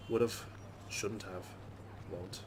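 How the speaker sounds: usual speaking voice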